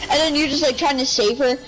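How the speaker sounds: ordinary talk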